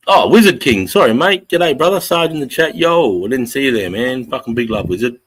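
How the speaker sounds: loud, high, delighted hello; excited greeting. Real, not acted. Streamer greeting a regular who just appeared in chat, excited and loud.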